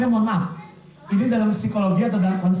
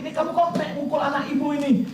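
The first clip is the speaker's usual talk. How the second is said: mock scolding mother; 7 semitones higher than usual. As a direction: play the mother confronting the other child. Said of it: "misalnya kan tadi".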